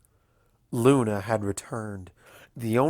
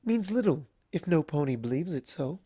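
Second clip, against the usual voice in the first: a slightly raised, composed lady's voice; lightly voiced Rarity; 6 semitones higher than usual. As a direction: speak with poised, earnest conviction. Rarity speaking to Princess Luna.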